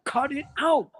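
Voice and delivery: high-pitched mock yell at Homer; imitating a yell. Coach yelling at the announcer to stop the hype. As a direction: bark it like an angry coach pacing. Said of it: "Like he's yelling".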